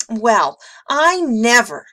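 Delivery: loud, high huffy protest; affronted sniff. A narrator's reading. Monster Cynthia told she has failed, huffy and affronted. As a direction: huff it out, deeply offended. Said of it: "she sniffed".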